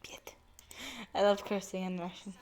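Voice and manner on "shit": soft, breathy whisper; whispered curse. Real, not acted. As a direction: swear under your breath.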